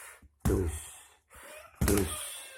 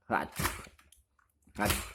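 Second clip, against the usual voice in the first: ordinary delivery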